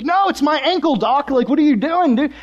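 high, insistent mock protest; exasperated patient. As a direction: object as if the doctor is clueless. Patient objecting to a clueless doctor.

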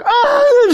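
sudden high yelp of shock; imitating a gasp of horror, high and loud; startled cry. Mimicking Jess hearing the dog is hurt, yelping high and loud.

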